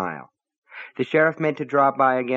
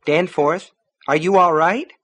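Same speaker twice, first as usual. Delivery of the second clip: worried calling; 7 semitones higher, 5 dB louder than usual. A narrator's reading. Wife calls through the door.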